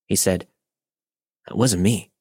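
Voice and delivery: ordinary delivery, nothing marked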